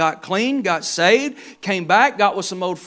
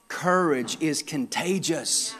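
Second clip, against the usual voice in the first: quieter, slow, letting it sink in; soft, slow repetition; 5 semitones lower, 8 dB quieter, slower than usual. Real, not acted. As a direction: drop the voice and let it settle. Key point repeated softly.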